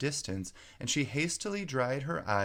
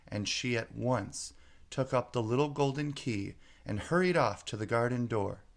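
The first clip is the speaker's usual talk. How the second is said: plain reading voice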